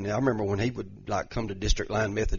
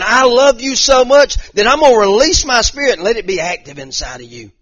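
louder and higher, climbing with each line; full-force preaching; 8 semitones higher, 10 dB louder than usual. Acted. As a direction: preach it out, building to a shout.

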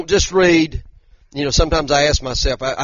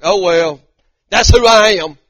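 raised, higher mock-careless retort; defiant shrug; 5 semitones higher, 7 dB louder than usual. Preacher imitating his own excuse for loose words, defiant shrug. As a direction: toss it out loudly, imitating your own excuse.

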